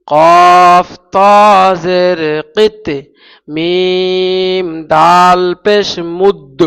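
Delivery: কফ ত and মুদ drawn out very slowly and loud, each final consonant given a bouncing jolt; slow recitation demo. Shown as a demonstration. Teacher reciting slowly so the student hears the qalqala, loud and drawn out. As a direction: chant কফ ত, কিত and মুদ slowly, letting the last letter bounce. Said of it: "ঝাটকা দিতে হবে".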